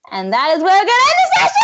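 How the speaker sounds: fast, high excited shout; real celebratory yell; triumphant announcement. Real, not acted. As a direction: build into a loud, elated sign-off. Game master triumphantly ends the session.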